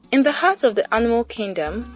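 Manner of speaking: plain reading voice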